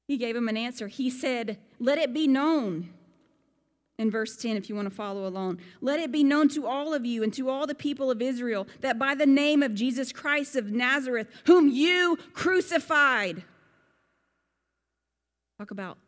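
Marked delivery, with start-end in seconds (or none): 1.8-2.9 loud, slow proclamation; bold declaration. Retold or imitated. Peter addressing the Sanhedrin, loud slow proclamation. 5.8-13.4 bold accusation. Retold or imitated. Peter accusing the rulers, louder to the end.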